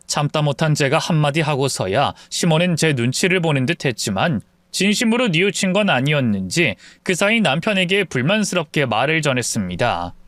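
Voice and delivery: ordinary delivery, nothing marked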